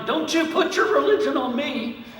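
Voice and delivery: high-pitched mock yell; imitated yelling. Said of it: "She was yelling".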